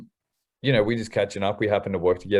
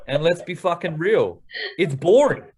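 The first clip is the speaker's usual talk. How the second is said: fired up; 9 semitones higher than usual. Acted. Sales trainer fires up his team.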